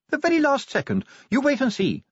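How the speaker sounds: high-pitched anxious lady; Sybil's voice. A narrator's reading. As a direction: keep Sybil up high and brisk. Sybil insists.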